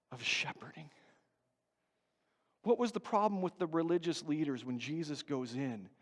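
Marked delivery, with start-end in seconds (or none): 0.1-0.9 softer, breathy whisper on the last words. Acted. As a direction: hush it like a secret. Hushed climax of the point.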